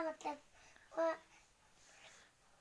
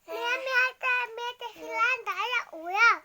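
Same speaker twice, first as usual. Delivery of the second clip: excited calling out; 7 semitones higher, 8 dB louder than usual. Real, not acted. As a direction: shout for mum with excitement. Child calls out excitedly. (bé gọi mẹ hào hứng, to và cao)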